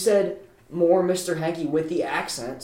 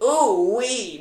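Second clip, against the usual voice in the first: higher-pitched cartoon catchphrase; raised, playful impression voice; Mister Poopy Butthole; 6 semitones higher, 4 dB louder than usual. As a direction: demonstrate a high character squeal. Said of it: "it's like".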